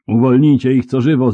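loud, high urgent command; calling out. A narrator's reading. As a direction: call out to have them freed. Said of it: "zawołał".

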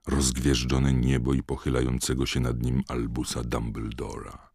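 ordinary delivery

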